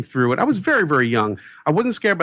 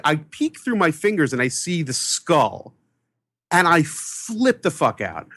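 ordinary delivery

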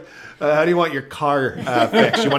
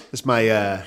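normal talking voice

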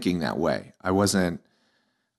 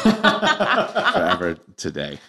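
laughing; much slower than usual. Real, not acted. Joking he'll keep junk gift.

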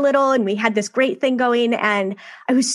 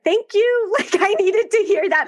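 relieved thanks; 10 semitones higher than usual. Retold or imitated. Relieved reply to stake president.

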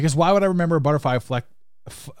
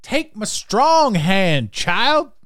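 slow, dramatic and higher, drawn out; hammed-up creepy butler; 7 semitones higher, 4 dB louder, much slower than usual. Host acting the misremembered movie line, slow hammy butler voice. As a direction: ham up the remembered line, stretching every word. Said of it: "I remember it as".

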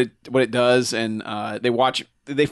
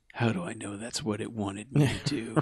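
soft, low muttered worry; paranoid mumble; 4 semitones lower, 11 dB quieter than usual. Retold or imitated. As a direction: mutter it to yourself.

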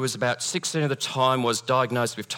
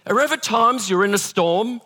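ordinary delivery

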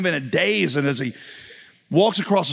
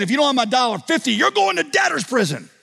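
angry threat; 10 semitones higher than usual. Retold or imitated. Forgiven man hauling his buddy off, furious. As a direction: snarl it out, mounting in pitch.

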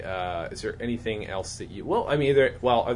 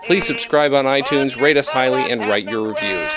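bright, loud promo read; announcer read; 3 semitones higher, 10 dB louder than usual. Acted. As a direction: sell the credits like a radio announcer. Podcast outro credits read.

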